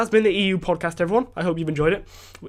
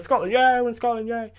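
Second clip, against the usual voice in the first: higher, sing-song put-on celebration; mock cheer; 7 semitones higher than usual. Crossing the border into Scotland, mock cheering. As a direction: cheer the sign like a tourist. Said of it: "there's one that says".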